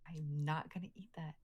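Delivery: whispering under her breath; a quick unvoiced aside to the table; whispered aside. Real, not acted.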